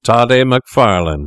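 drawn-out herald-like address; insistent call. A narrator's reading. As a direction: announce the name slowly and pointedly. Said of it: "repeated Fettes".

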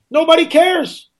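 high, drawn-out exasperated cry; indignant outburst. Real, not acted. Host lamenting missing public outrage to listeners, high exasperated cry. Rant peaks, line repeated lower.

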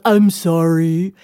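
a hint of a sheepish husband. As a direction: draw the apology out gently. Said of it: "was like".

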